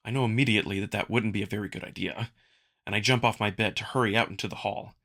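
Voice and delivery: usual speaking voice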